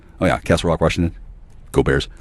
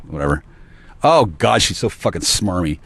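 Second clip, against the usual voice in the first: usual speaking voice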